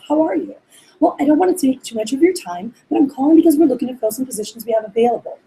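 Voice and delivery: polite, scripted phone manner; nice, sweet receptionist. Retold or imitated. New receptionist cold-calling a student, nice but scripted. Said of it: "sounds very nice and very sweet".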